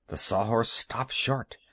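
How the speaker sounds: plain reading voice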